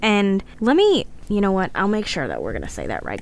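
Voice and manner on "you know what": ordinary delivery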